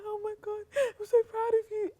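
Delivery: high-pitched, overcome with proud tears; mock weepy, squeaky voice; imitated tearful mum. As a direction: imitate mum crying with pride. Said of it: "I just see my mum in tears".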